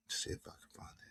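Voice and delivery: whispered under breath; whispered aside. Real, not acted. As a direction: whisper to yourself while searching. Searching comments under breath.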